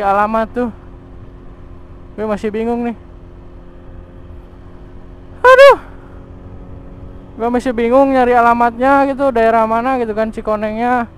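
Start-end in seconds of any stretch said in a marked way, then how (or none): 5.4-5.8 frustrated yelp. Real, not acted. Lost, exclaims in frustration.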